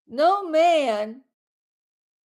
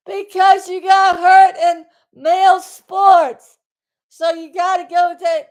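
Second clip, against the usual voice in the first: real yelling; 5 semitones higher, 8 dB louder than usual. Real, not acted. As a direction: shout it out, fed up with them. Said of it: "Am I yelling?".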